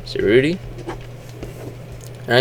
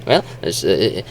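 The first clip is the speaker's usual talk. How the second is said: usual speaking voice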